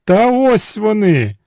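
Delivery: high, slow, loud cry; high pleading cry. A narrator's reading. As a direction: hold them up and shout. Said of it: "закричала царівна".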